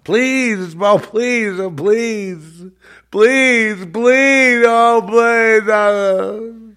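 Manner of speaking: high, loud drawn-out begging; mock wailing plea. Mimicking revival beggars crying on stage, mock wailing. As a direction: wail each plea in hammed-up despair. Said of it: "they're begging and begging and they're crying".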